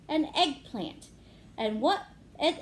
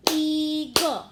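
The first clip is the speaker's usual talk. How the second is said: "Eagle" loud, in two drawn-out beats; clapped-out syllables; 4 semitones higher, 8 dB louder than usual. Shown as a demonstration. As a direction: chant "Eagle" as EE-gul, a loud beat on each. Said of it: "One more time".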